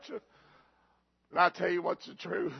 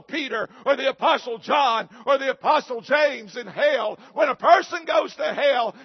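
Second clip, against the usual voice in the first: rising, louder hellfire preaching; preaching at full force; 6 semitones higher, 8 dB louder than usual. Real, not acted. Pastor preaching to his church on hell, building to a shout.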